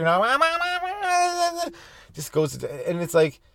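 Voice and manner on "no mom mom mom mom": put-on very high wailing kid cries of mom; toddler calling mommy. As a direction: wail for mommy like a toddler losing her screen. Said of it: "starts screaming for mommy".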